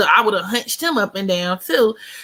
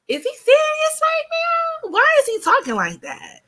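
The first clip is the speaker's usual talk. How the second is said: put-on incredulous girl. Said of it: "That girl, I be like".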